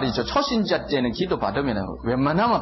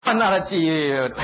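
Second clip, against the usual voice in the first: teasing scold; 6 semitones higher, slower than usual. Real, not acted. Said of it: "무슨 장입니까".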